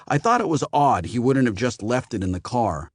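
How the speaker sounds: usual speaking voice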